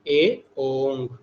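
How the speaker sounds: each syllable stretched out; ए, ओंग chanted very slowly; slow recitation. Shown as a demonstration. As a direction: recite slowly so students can follow. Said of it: "तीसरा प्रत्याहार कौन सा है?".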